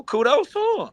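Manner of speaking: sarcastic high-pitched kudos. Real, not acted. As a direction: squeak it out, mocking. Mock praise to doubters.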